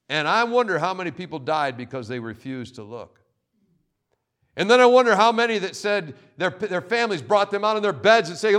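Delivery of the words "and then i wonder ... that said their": ordinary talk, nothing marked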